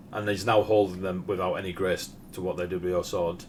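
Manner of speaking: usual speaking voice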